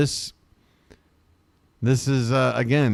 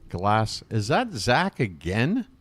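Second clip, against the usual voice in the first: ordinary talk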